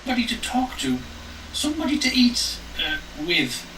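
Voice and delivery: put-on high, creepy old woman; a hungry old hag, voiced up high.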